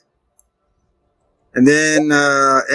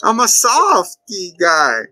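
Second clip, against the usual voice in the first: high, slow whimpering clown voice; whiny hurt clown; 8 semitones higher, slower than usual. Retold or imitated. Mocking Pennywise whimpering at the insults, high and whiny.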